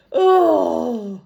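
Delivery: loud high groan of disgust. Real, not acted. Narrator recoiling from Dave's scrawny legs. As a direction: recoil at something unappetizing.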